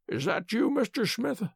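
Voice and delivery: soft, weak whisper of a sick man. A narrator's reading. Sick Holmes greeting his visitor, whispered. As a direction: ask faintly, voice softened right down. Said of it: "Holmes whispered".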